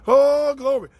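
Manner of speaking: bursting out high and loud in praise; a sudden joyful shout of glory; church-style cry of praise; joyful exclamation. Real, not acted. Praising Pastor Gina's help.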